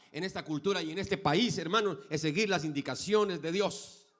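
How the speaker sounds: loud, high, emphatic sermon; full-force preaching. Acted. Pastor preaching loudly to the brothers and sisters. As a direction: drive the lesson home.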